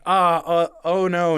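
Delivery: a mild mock panic with nervous stumbles; panicked. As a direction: caught out and backing away. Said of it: "he's just like".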